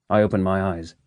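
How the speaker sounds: plain reading voice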